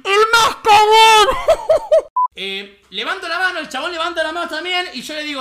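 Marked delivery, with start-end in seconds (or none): 0.0-2.3 very loud, high drawn-out screech; acted screech. Streamer reenacting his last resort, hammed-up high scream. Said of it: "Literalmente hice esto".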